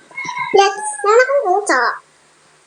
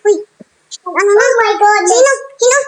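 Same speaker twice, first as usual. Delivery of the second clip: ordinary talk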